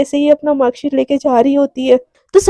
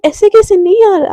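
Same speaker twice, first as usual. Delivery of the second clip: excited; 6 semitones higher, 4 dB louder than usual. Retold or imitated. Radhika protests to Devika.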